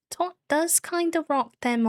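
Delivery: bright high delighted remark. Real, not acted. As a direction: admire the look.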